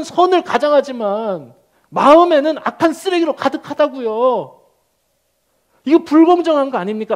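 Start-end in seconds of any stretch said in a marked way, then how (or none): 5.8-7.1 higher, louder demanding protest; a prophet arguing his case; indignant challenge. Acted. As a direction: press God with the unfairness. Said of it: "라고 따지는 거고요".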